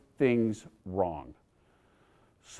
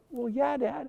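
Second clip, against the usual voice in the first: daughter voice; 9 semitones higher, 10 dB quieter, slower than usual. Retold or imitated.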